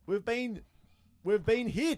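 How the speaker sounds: loud, high fake distress call; mock alarm. Host telling co-host they are hit, mock alarm. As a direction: announce a hit like a hammed-up disaster. Mock disaster as hosts go dark.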